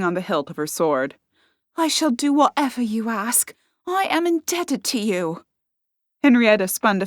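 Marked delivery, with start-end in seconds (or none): 1.8-5.4 put-on lightly higher young girl's voice; a grateful young woman, a bit higher; eager young servant girl, lightly voiced.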